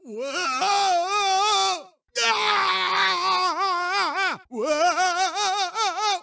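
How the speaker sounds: high, long wavering cry; alarmed yell. Acted. Game character caught in mishap, wavering yell. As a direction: wail out high and drawn out.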